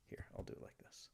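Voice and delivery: a soft unvoiced aside to himself; muttered whisper while fumbling with the camera. Real, not acted. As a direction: breathe the words without voice.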